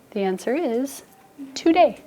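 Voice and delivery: usual speaking voice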